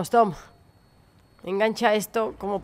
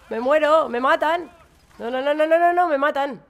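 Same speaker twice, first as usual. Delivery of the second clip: panicked; 5 semitones higher than usual. Real, not acted. Nearly dead in the game.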